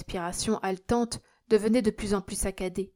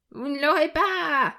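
slow, loud, high delirious shout; high-pitched cry; 8 semitones higher, 6 dB louder than usual. A narrator's reading. Delirious miser defies imaginary enemies, crying high. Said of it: "criait-il d'une voix aiguë".

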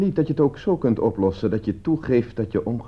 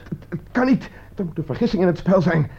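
high, stuttering disbelief; higher, shaken, repeating himself; shocked stammer; 11 semitones higher, 4 dB louder than usual. Acted. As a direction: stumble over the words in shock. Stammering shock after the calls.

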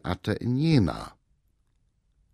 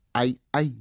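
scornful; 3 semitones higher than usual. A narrator's reading. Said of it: "fügte er noch höhnisch hinzu".

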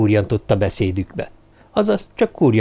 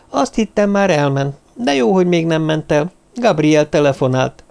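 higher-pitched, lightly acted female character; a hint of Sophie, pitched up; lightly voiced Sophie; 6 semitones higher than usual. Sophie passing Gabriel's phone message to the lieutenant, lightly voiced higher.

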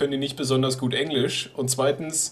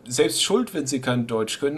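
ordinary talk, nothing marked

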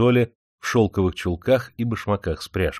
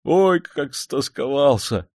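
higher, slow, heartfelt confession; a servant confessing his longing for home; sincere longing; 7 semitones higher, slower than usual. A narrator's reading. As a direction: admit your homesickness from the heart. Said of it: "искренне признался Орёлка".

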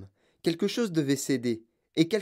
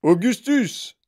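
loud, raised call; higher, forceful call from a father; father's shout; 7 semitones higher, 7 dB louder, slower than usual. A narrator's reading. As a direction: shout the name as a warning. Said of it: "cria monsieur Gloop".

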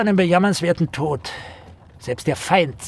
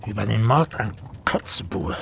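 hushed, secretive whisper; 6 semitones lower than usual. Acted. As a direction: lean in and whisper it. Confiding the murder topic.

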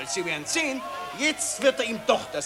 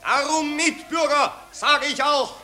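pompous orator addressing the crowd; patriotic street speech; 4 semitones higher, 5 dB louder than usual. Acted. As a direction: harangue the citizens with fake grandeur. (pathetische Straßenrede)

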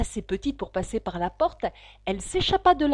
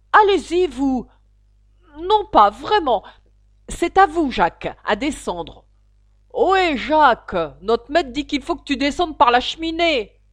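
dispute criée; 4 semitones higher, 9 dB louder than usual. Acted. Animaux se renvoient la corvée.

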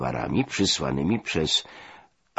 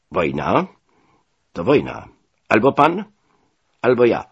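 louder, rising to a high ultimatum; threatening; 6 semitones higher, 8 dB louder than usual. A narrator's reading. Commodore declaring war on the delegate, threatening. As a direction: lay down a threat in short, emphatic phrases. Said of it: "w głosie Henra Hana czuć było groźbę".

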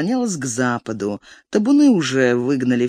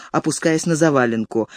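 ordinary delivery, nothing marked

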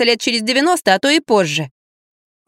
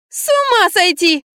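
high, drawn-out squeal; 9 semitones higher, slower than usual. A narrator's reading. Girl outraged at Lampa, squealing. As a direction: squeal in outrage, stretching the words. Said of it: "визжала Лизавета".

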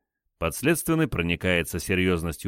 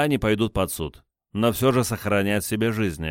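usual speaking voice

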